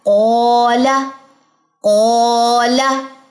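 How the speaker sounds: ओला said very slowly with a full, heavy o; heavy drawn-out recitation. Shown as a demonstration. Teacher showing the class how to recite it heavily. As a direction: stretch ओला out heavy and slow. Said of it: "तो ये कैसे पड़ा जाएगा?".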